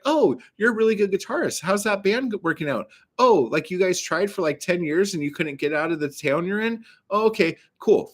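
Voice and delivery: high-pitched mock admiration; sarcastic. Customer needling a show-off guitar salesman, sarcastic.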